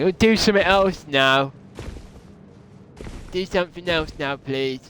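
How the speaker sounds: high-pitched put-on pestering voice; mock demanding viewers. Streamer mimicking bored chat wanting something else, mock demanding. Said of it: "Before you start saying".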